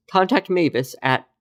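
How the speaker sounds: usual speaking voice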